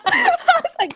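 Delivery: high laughing burst. Real, not acted. Guest recalling the wine offer, laughing high. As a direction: crack up at the offer.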